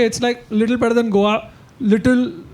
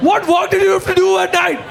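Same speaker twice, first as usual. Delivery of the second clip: higher, fast demanding shout; a teen demanding to know where his parents went, shouting fast; mock shout; 10 semitones higher, faster than usual. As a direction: fire it at them, accusing. Kid demanding answers, retold.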